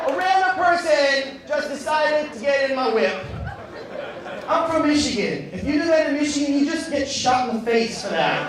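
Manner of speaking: loud, high comic indignation; outraged rant. Acted. Comic telling the crowd about the intruder, loud outrage. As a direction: rant in disbelief at full voice.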